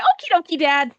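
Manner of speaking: put-on high, cheery boy; lightly voiced happy child; chirpy kid voice. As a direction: bounce it like an eager kid. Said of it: "And Gus is like".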